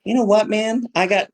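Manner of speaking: a high, buddy-buddy voice; casual guy. Retold or imitated. As a chummy guy bragging about a student's card, high and casual. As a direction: play a chummy guy telling a story. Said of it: "the kind of person who's like".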